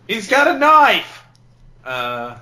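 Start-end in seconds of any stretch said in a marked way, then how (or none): 0.1-1.2 high, loud, drawn-out cry of alarm; hammed-up shriek of warning; mock scream. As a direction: scream it in hammy panic. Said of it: "Shyamalan screamed".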